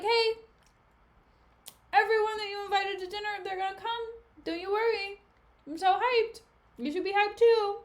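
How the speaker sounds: high, bright-eyed peppy cheer; bubbly Desdemona. Retold or imitated. Desdemona cheerfully telling Othello his dinner guests are coming, peppy and high. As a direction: gush like an excited hostess.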